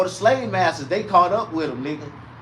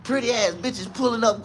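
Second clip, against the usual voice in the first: ordinary talk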